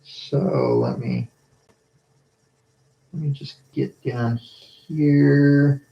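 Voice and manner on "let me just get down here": ordinary delivery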